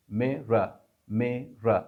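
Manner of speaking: मेरा said mera with a tapped R between vowels; linking R in mirror. Shown as a demonstration. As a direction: say मेरा keeping the middle R. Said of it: "आप दोबारा उस आर को पढ़ोगे".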